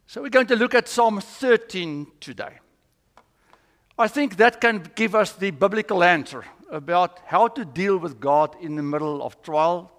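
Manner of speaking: ordinary talk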